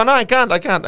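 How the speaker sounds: high, loud protest; desperate pleading. Retold or imitated. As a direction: plead that you can't go on. Dizzy recruit pleading, acted.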